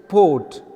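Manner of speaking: PORT struck loud and drawn out; stressed syllable. Shown as a demonstration. Teacher isolating the second sound, stressed PORT. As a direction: put the force on port. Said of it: "the strike is on a second syllable".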